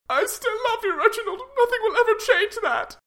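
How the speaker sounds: very high desperate pleading; pleading wife voice. Acted. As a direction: beg him, high and thin.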